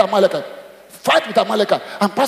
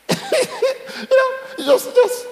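squeaky mock voice; much slower than usual. Pastor mimics a flustered reaction.

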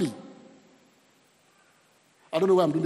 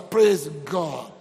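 ordinary talk

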